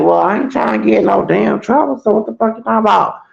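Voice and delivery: high, heated comeback; annoyed retort. Retold or imitated. Speaker firing back at the cussing roommate, heated. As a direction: snap back defensively, fast at the end. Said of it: "And I'm like".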